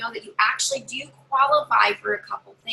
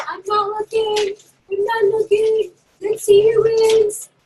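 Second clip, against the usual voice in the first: high, slow, drawn-out suspense; loud, high party-game build-up; teasing prize draw; 10 semitones higher, 6 dB louder, slower than usual. Acted. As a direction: stretch it out playfully before the reveal.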